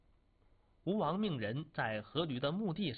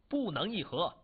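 raised, higher-pitched objection; mild cry of protest; 4 semitones higher, 3 dB louder than usual. A narrator's reading. Minister bursts in to oppose the king's peace deal, mildly shouted. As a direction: call out an urgent refusal, without a full shout. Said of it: "对夫差大叫".

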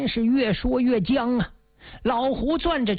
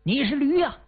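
a sharp, higher snap of an insult; mild angry jab; fierce insult; 6 semitones higher than usual. A narrator's reading. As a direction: spit the word out at him. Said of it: "狠狠地说了一声".

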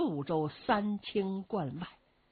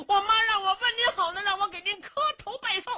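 high, loud, rough-and-ready hero greeting his mother; a boisterous, high-pitched bumpkin hero; Cheng Yaojin's voice; 14 semitones higher, 5 dB louder, faster than usual. Acted. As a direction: pitch it way up like a brash big-hearted fellow.

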